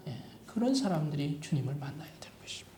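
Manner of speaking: quick whispered repeat of the point; hushed fast aside to the congregation. Real, not acted. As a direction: drop to an unvoiced whisper, fast.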